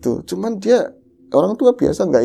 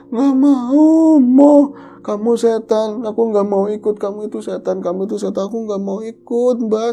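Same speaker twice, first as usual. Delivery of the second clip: put-on frightened child; 8 semitones higher than usual. Child sleep-talking refusing a ghost.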